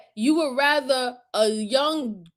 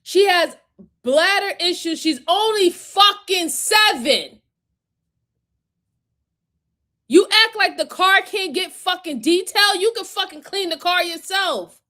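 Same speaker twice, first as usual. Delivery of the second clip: angry rant; 5 semitones higher than usual. Real, not acted. Confronting the abusive father on camera, rising real anger. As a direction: let the fury climb as you list it.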